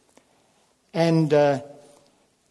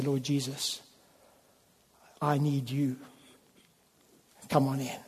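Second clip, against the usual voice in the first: much softer, humble plea to Jesus; quiet prayer; 3 semitones lower, 9 dB quieter than usual. Shown as a demonstration. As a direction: hush down into a simple prayer. Example prayer at the door.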